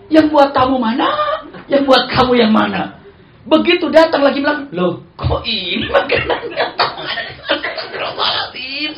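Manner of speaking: high, loud laughing questions. Real, not acted. Preacher laughing over the food mixup, high and loud. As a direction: laugh through the confusion.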